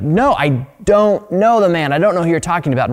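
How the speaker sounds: emphatic denial. Acted. Peter denies knowing Jesus to an accuser, emphatic protest. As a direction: deny it hard, stretching the first words high.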